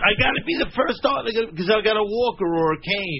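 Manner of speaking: high squeaky imitation of a friend; mocking a friend's high little voice; High Pitch Eric voice. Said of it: "He insists".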